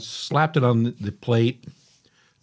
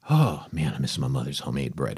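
1 wistful sigh; 10 semitones lower, 5 dB quieter than usual. Real, not acted. Missing his late mother's bread.

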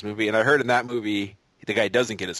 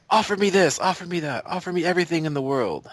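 high-pitched, emphatic film quote; escalating demands easing slightly in pitch; acted film demand; 7 semitones higher, 3 dB louder than usual. Acting out Inigo's film demand.

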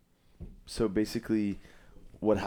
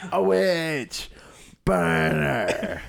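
high, loud hammed-up yell; mob cry; 8 semitones higher, 5 dB louder, much slower than usual. Quoting the villagers' favorite line, mock mob shout. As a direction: cry out like an accusing crowd.

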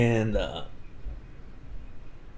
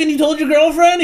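much higher, louder, quick disbelieving question; indignant scolding; 13 semitones higher, 7 dB louder, faster than usual. Retold or imitated. Crepsley scolding Darren over telling his girlfriend, shrill. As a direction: scold him in a shrill mock outrage.

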